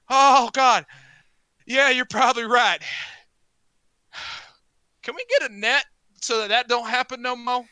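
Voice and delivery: loud, high-pitched grumbling; dunked heckler. Acted. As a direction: complain loudly after a cold dunking. Game master voices the dunked heckler.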